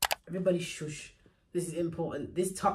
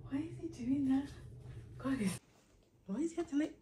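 amused pet talk; 8 semitones higher, 8 dB quieter than usual. Real, not acted. Puzzled by her dog.

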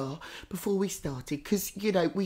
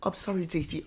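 ordinary delivery

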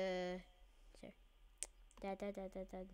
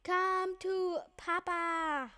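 playful voice; 8 semitones higher, slower than usual. Pretend. Chasing a circle.

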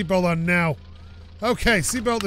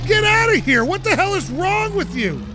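high, loud angry yell; real furious outburst; furious shouting; 7 semitones higher, 5 dB louder, slower than usual. Real, not acted. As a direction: bellow at the driver who hit you. Yelling at the driver.

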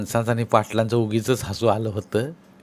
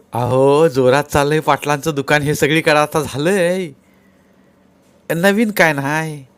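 villager Mahadu; 4 semitones higher, 5 dB louder than usual. Acted.

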